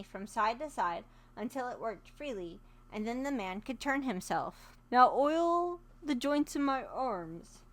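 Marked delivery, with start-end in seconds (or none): 4.9-7.6 slow, louder creaking tin voice; stiffly ask, as if rusted; slow metal man pleading. Acted.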